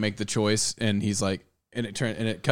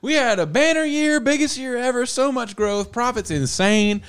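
much higher and louder, hammed-up gloating exec; mock corporate boss; 11 semitones higher, 4 dB louder than usual. Bosses bragging to staff, hammed-up high gloat. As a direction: crow about record profits in a squeaky boss voice. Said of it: "corporations just be like".

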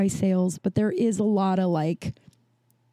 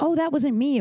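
higher-pitched, offhand quoted denial; casual denial; 7 semitones higher than usual. Retold or imitated. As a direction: toss it off lightly, pitched up. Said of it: "I was just like".

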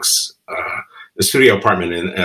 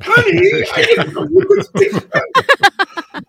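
much higher, slow, laughing wife voice; wife's surprised quote; slower than usual. Retold or imitated. Wife in the cab amazed he speaks Spanish, high laughing squeal. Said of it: "she was slapping me from the back, going".

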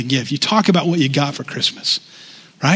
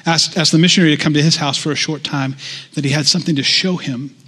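normal talking voice

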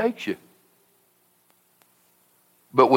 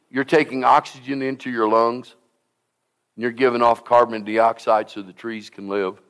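ordinary delivery

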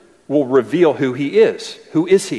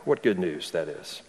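usual speaking voice

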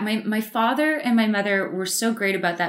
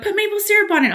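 mock friends' voice; 12 semitones higher, 4 dB louder, faster than usual. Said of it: "They're like".

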